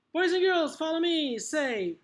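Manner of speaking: high, loud teacher call; rally the children brightly, voice projected; calling to class. Acted. Teacher getting young pupils to repeat, calling out.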